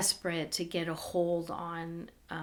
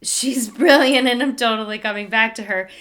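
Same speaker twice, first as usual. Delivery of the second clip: louder, laughing through the words; She's brilliant said with a laugh, louder; laughing retelling; 2 semitones higher, 10 dB louder, faster than usual. Retold or imitated. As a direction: say it with a grateful laugh. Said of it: "I thought to say".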